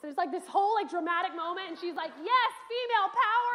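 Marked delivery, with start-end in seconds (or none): 2.2-3.5 cheer. Retold or imitated. Pocahontas cheering.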